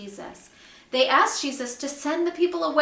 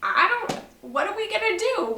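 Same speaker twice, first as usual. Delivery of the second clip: panicked disciples; 8 semitones higher than usual. Retold or imitated. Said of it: "They're like".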